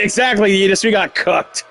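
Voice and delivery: normal talking voice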